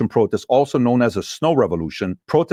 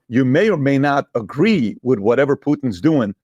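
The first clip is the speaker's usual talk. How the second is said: ordinary delivery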